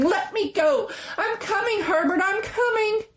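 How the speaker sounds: high, sobbing cry; calling to her son through tears. A narrator's reading.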